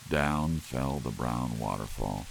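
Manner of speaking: usual speaking voice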